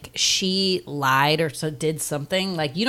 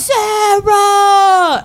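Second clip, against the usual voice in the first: high, very loud drawn-out cry; hammed-up holler through the rain; mock baritone bellow; 11 dB louder than usual. Father calling his daughter through rain, bellowing. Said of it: "baritone just being like".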